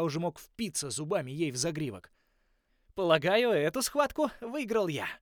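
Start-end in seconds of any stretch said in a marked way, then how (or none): 2.9-5.1 smug. A narrator's reading. Smug victory remark.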